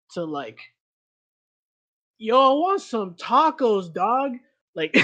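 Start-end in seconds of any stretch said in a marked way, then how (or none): 2.2-4.4 loud, higher, drawn-out slangy shout-out; casual street slang. Shown as a demonstration. Showing friend the swing away from professor talk, exaggerated casual slang. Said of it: "I'll literally go from".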